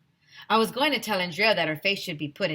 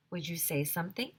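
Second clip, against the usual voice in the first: ordinary delivery, nothing marked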